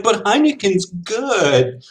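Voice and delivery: high, slow mock protest; naive beer snob. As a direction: drag out the whiny defense of Heineken. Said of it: "Some people are like".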